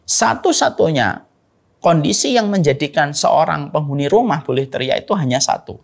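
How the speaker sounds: usual speaking voice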